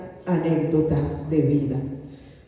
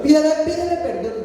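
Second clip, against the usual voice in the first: higher, quick, urgent nudge; insistent Holy Spirit; 7 semitones higher, 4 dB louder, faster than usual. Retold or imitated. Holy Spirit prodding a reluctant believer, insistent. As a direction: press the repeated command, pitched up. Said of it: "Y el Espíritu Santo".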